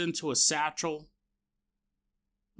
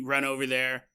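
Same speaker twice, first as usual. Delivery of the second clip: ordinary talk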